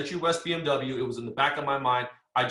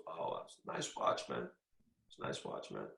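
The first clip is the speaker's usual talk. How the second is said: ominous hushed boss; 13 semitones lower, 13 dB quieter than usual. Retold or imitated. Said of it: "he goes".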